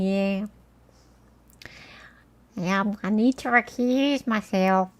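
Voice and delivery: slow, irritating put-on lawyer voice; annoying Cashman voice. Lawyer recusing herself from the case, mocking impression. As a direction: drag it out in a badly faked American accent. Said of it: "She had a voice kinda like this".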